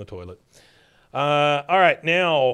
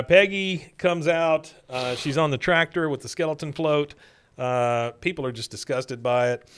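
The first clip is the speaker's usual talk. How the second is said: usual speaking voice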